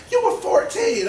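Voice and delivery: very high, loud mock yell; incredulous mom. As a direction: throw it back in a high mother's shout. Mother's outraged retort, acted.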